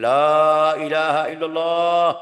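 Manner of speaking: chanted high and loud, slow and stretched out; showy loud zikir. Retold or imitated. Preacher mocks a show-off chanting zikir, in a loud slow chant. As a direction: belt out the zikir to be admired, slowly. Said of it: "teriak suara atau kencang suara sedikit".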